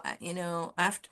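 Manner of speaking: usual speaking voice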